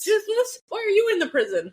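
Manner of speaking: high, quick, playful call to a pet; excited call. Acted. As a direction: call out to the dragon, delighted and fast. Teasing the dragon on screen.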